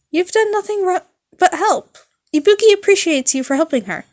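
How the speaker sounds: put-on high, loud bubbly girl voice; high loud cheerful thanks; chipper rock girl. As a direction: toss it off brightly like a rock star. Ibuki reassuring nurse.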